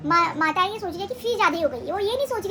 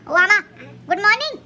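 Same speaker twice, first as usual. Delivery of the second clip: calling out; 7 dB louder than usual. Real, not acted. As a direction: call out loud to grandma. Calling out to grandmother. (दादी को ज़ोर से पुकारना)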